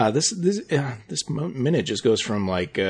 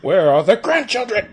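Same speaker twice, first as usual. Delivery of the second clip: nagging mother; 9 semitones higher, 4 dB louder than usual. Retold or imitated.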